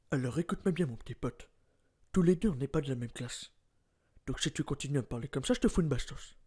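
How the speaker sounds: low quiet threat to a little punk; menacing tough guy. Acted. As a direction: tough guy warning someone he'll slap him. Same threat, second take.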